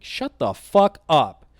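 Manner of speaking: slow, heavy angry put-down; angry retort. Retold or imitated. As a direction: bite each word out. Telling off the threatening guy.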